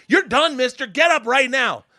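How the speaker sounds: high, loud furious telling-off; angry scolding. Retold or imitated. Teacher to student and the mafia, high angry shout. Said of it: "she's talking to me".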